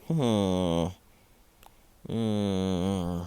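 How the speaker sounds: long, drawn-out hmm; thinking hum. Acted. Izuku choosing a quirk name, pondering hum. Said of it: "Izuku thinks about it".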